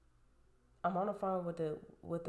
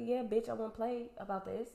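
raised retort; 6 semitones higher, 4 dB louder than usual. Retold or imitated. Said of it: "I'm like".

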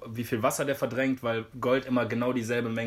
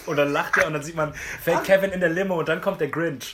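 usual speaking voice

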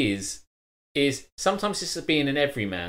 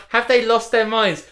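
imagined viewer; 9 semitones higher, 6 dB louder than usual. Retold or imitated. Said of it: "People on the stream will be thinking".

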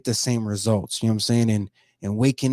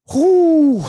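a big, high exhaled whew after holding back tears; loud whew of release; 6 dB louder than usual. Real, not acted. Vlogger pausing before reading his quote, loud whew. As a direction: let the feeling out in one loud whew.

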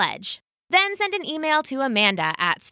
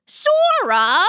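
loud, high outraged question; spluttering disbelief; 8 semitones higher, 6 dB louder than usual. Acted. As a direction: splutter the name as an appalled father. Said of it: "Mister Rat spluttered".

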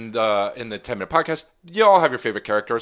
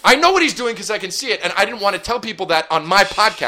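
higher, louder exasperated complaint; 10 semitones higher, 5 dB louder than usual. Real, not acted. [name] complains that Brian gives him the finger, exasperated. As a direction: snap back, fed up.

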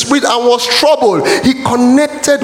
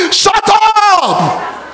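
rebuking shout; 13 semitones higher than usual. Real, not acted. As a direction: cut the doubter off hard. Preacher rebukes the doubter.

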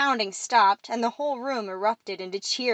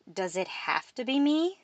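mildly reluctant question, higher at the end; disinterested; 4 semitones higher than usual. A narrator's reading. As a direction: ask as if you'd rather not. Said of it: "Mora asked with disinterest".

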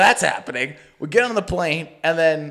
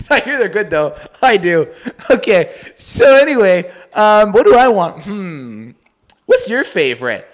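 louder, mocking a chatty customer at the counter; mocking zany chatter; 6 dB louder than usual. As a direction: ham up the friend's chatter with the barista. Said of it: "You know, like, zany guy".

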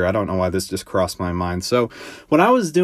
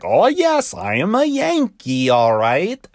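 very high-pitched, hammed-up bad American accent; mock a terrible attempt at sounding American, pitched way up; exaggerated phony Yankee accent, squeaky and drawn out; Alan Rickman's fake American accent; 9 semitones higher, slower than usual. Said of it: "by far the worst freaking, like, impression".

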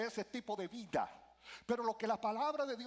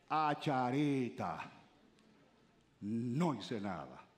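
put-on low, slow, regretful sigh of an old person; regretful lament; 8 semitones lower, slower than usual. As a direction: lament it slowly and low, like a life wasted. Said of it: "de los cuales digas". (lamento lento y grave de arrepentimiento)

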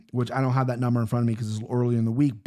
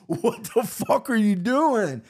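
slow, higher mock alarm; shocked dad; 8 semitones higher, slower than usual. Father seeing his son's hand in the trap, mock shock. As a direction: play the startled dad lightly. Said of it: "He's like".

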